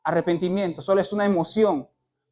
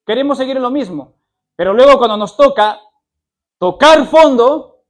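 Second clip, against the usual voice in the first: fiery preaching; 4 semitones higher, 10 dB louder than usual. Acted. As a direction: hammer the point home to the congregation. Preaching at full force.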